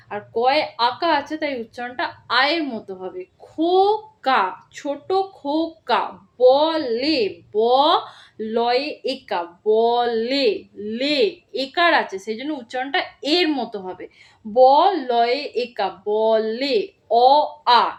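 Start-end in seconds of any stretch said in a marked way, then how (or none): 3.4-11.4 slow spelling out. Shown as a demonstration. Teacher spelling out a word slowly for first graders. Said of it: "এরম করে তোমরা যদি পড়ো". 14.5-17.9 slow spelling out. Shown as a demonstration. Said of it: "এরম করে তোমরা যদি পড়ো".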